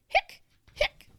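two loud sharp hics; abrupt hiccup bursts. Acted. Boy after failed lemon cure, loud hiccups.

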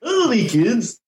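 high, cheerful purple dinosaur voice; Barney. Acted. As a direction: greet the kids with big friendly cheer. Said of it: "Now we're doing Barney".

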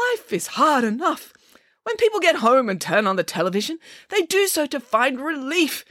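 swooping, impassioned little speech; Nanny Piggins, indignant. Acted. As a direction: scold with theatrical conviction. Nanny Piggins lectures the director.

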